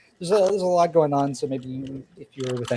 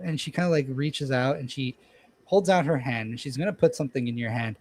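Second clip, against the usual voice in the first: normal talking voice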